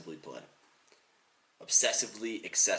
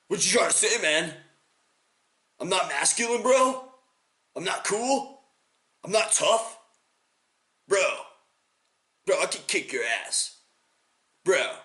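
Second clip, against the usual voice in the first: high, loud wounded macho protest; offended male ego; 10 semitones higher, 3 dB louder than usual. Acted. Tough guy objecting to being called daughter, offended. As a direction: bristle like an insulted tough guy. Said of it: "appeal well with the male ego".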